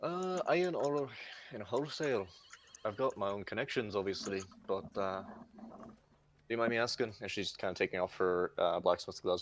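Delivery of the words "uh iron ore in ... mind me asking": put-on Irish accent. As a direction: mull it over in an Irish accent. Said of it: "sorry for my Irish accent".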